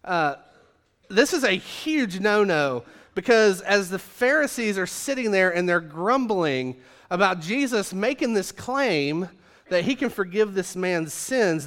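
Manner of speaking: ordinary talk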